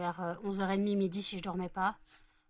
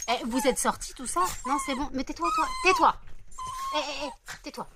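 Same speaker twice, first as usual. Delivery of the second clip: higher and louder, laughing at the jumping dogs; excited pet talk; 7 semitones higher, 7 dB louder than usual. Real, not acted. To the dogs jumping for treats, loud high excited hushing. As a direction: cheerfully hush the overexcited pack.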